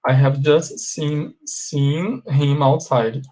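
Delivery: slow, careful dictation pace; slow model sentence. Shown as a demonstration. Teacher repeats the sentence to class, slow and deliberate. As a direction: spell out each word for learners. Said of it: "teacher repeats slowly for class".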